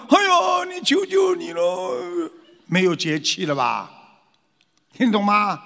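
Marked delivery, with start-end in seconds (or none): 0.1-2.3 high, slow, whimpering plea; mock frightened begging. Someone being scared pleading with a bully, hammed-up and high. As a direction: beg for mercy in a hammed-up high wail. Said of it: "人家吓你".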